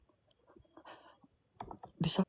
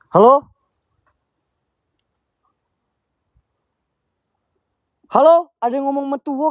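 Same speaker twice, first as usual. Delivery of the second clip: high loud frightened call; scared calling out; 13 semitones higher, 7 dB louder than usual. Real, not acted. Memanggil, mendengar suara "metuo. (memanggil ketakutan)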